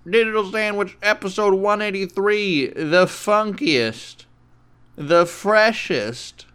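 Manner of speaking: slow, high DJ announcer; radio DJ intro. Acted. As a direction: stretch it out like a late-night DJ.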